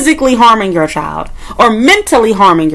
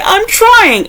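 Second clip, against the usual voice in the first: squeaky high whine; mock whiny excuse; 12 semitones higher than usual. Vlogger mimics a parent's excuse in a high whine.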